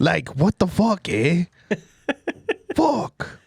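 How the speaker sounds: high, drawn-out Canadian grumble; mock Canadian accent. Doing a Canadian on request, exaggerated accent. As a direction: stretch it out like a cartoon Canadian. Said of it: "do a Canadian".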